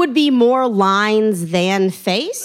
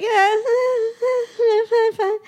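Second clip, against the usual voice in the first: much higher voice, half-sobbing through the words; tearful I'm fine; 13 semitones higher than usual. Retold or imitated. Woman on the platform tells the stranger she's fine, much higher and tearful. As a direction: fake being fine while crying. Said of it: "And I'm like".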